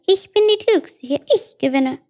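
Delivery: tiny high-pitched boastful fly. Acted. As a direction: squeak it out like a little fly. (hohe Fliegenstimme)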